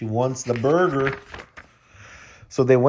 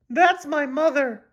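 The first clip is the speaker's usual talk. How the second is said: put-on high squeaky girl voice; slower than usual. Julie voice in read story.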